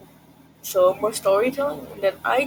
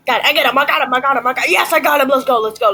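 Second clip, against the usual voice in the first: loud triumphant shouting; excited yelling; 4 semitones higher, 5 dB louder, much faster than usual. Real, not acted. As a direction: yell it, thrilled with the win. Excited over a kill.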